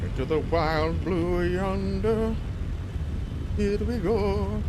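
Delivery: big showy pilot voice; hammed-up pilot. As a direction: announce the takeoff like a cartoon hero, drawn out. Hamming up the flight launch.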